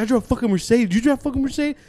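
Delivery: high, cocky mock challenge; taunting boast. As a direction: throw it at the teacher as a retold taunt. Student taunting the teacher.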